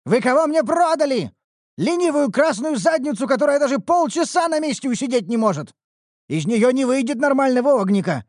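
high, loud angry tirade; ranting blacksmith. Acted. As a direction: scold her like a fed-up master. The smith rants about Neuru.

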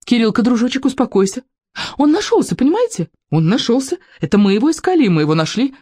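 high, agitated, pleading; nearly crying. A narrator's reading. A mother turns from policeman to boy, near tears. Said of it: "чуть не плача говорила мама".

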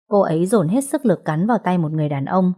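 ordinary delivery

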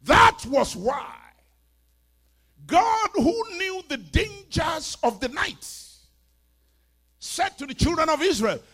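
high, loud, ringing proclamation; full-force preaching. Real, not acted. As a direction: thunder it out to the congregation.

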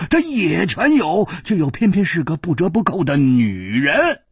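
high, loud, gushing praise; 拍掌狂喜; rapturous Hu. A narrator's reading. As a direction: swear it with wild enthusiasm. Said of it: "胡铁花一拍巴掌说".

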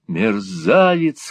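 slow, breathless with rage; furious cry. A narrator's reading. Nicholas facing the old miser, breathless rage. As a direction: choke out the insult. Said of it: "крикнул Николас, задыхаясь от бешенства".

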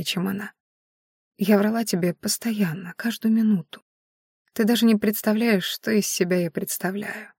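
soft, weakened, breath-saving murmur; barely audible whisper. A narrator's reading. Dying Maiari urges Viedash to forget her, faint whisper. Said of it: "едва слышно прошептала Маяри".

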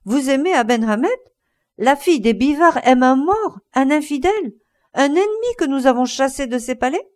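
a brother's shocked outburst at his sister's love; indignant outcry. A narrator's reading. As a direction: cry out in outraged disbelief. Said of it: "s'écria Don Carlos".

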